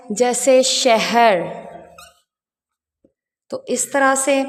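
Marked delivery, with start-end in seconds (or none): 0.1-1.4 drawn out slowly, each sound clear; teacher spelling out शहर slowly for kids; slow example word. Shown as a demonstration. Teacher showing children how शहर sounds, slow and clear. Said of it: "लेकिन अगर दरमियान में आए".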